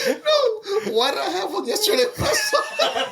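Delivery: laughing high and hard through the words; can barely get it out for laughing. Real, not acted. Friend cracking up over the movie hot dog, laughing.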